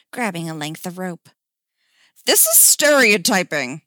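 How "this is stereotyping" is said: loud indignant protest; mock-outraged objection. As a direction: object loudly, affronted. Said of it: "Jason protested".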